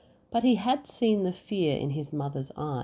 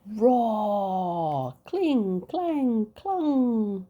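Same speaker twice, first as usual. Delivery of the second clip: louder, high roar and clangs; battle noises; 6 semitones higher, 3 dB louder, much slower than usual. Acted. Battle as Boy runs in.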